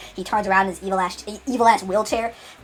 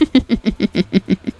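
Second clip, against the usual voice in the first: louder imitated villain laugh; evil laugh; 5 dB louder than usual. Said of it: "he laughs evil".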